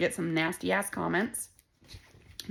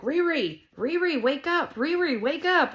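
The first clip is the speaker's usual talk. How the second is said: high, louder sing-out call; raised, insistent wake-up call; sister waking her; 9 semitones higher, 6 dB louder, slower than usual. Retold or imitated. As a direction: imitate a sister calling a sleeper awake. Imitates sister trying to wake her.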